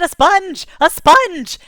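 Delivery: loud, high eureka cry; excited shout. Retold or imitated. Kid shouting his discovery to the glowing ball. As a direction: shout it like you just cracked it.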